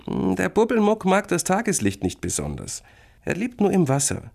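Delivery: put-on high, mysterious-tale girl voice; storytelling big sister. Sister tells brother about bathtub creature, explaining.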